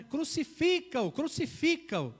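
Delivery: loud, slow mob chant of crucifica-o; crowd shout. Retold or imitated. Preacher voicing the crowd crying out against Jesus, loud and slow. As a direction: drag out crucifica-o like an angry crowd.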